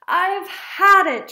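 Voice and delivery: high, loud, slow exasperation; fed up. Acted. Mother crumples over missing tickets, loudly exasperated. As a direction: burst out, dragging each word. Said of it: "her mother crumpled".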